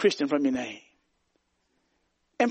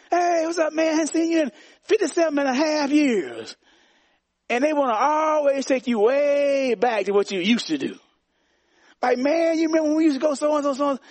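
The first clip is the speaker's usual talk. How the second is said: put-on old buddy voice; 10 semitones higher than usual. Old street friends recalling old times, mock high greeting. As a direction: play the old running buddy hailing you.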